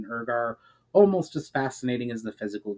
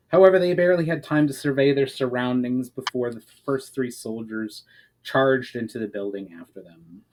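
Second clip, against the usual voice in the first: ordinary delivery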